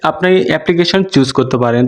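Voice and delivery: normal talking voice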